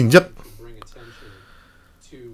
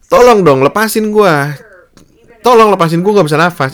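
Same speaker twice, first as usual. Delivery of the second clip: high, louder pleading cry; imitated begging; 7 semitones higher, 3 dB louder than usual. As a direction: plead to be released, pitched high. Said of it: "Itu kalimat terakhir yang dia keluarin".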